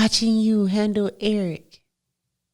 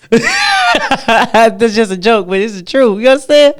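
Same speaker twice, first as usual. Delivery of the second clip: much higher and louder, laughing praise; loud excited hype; 7 semitones higher, 13 dB louder than usual. Real, not acted. Daughter loudly hyping her mother's looks.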